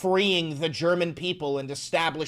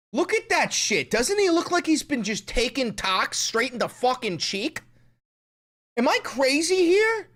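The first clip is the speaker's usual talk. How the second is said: incredulous; 7 semitones higher than usual. Real, not acted. Incredulous at face.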